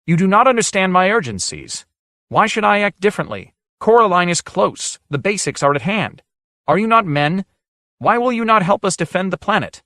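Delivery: high, loud indignant outburst; cried out. A narrator's reading. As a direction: cry out in shame turned to indignation. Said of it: "He cried out".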